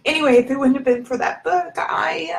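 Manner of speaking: crying while trying to carry on; choked up. Real, not acted. Woman crediting a book for her life, choked up. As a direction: push on through real tears, voice lifting. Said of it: "it's making me emotional".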